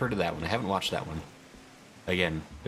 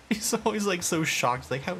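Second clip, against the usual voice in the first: amused squeal; 12 semitones higher than usual. Real, not acted. Mocking the character's shock.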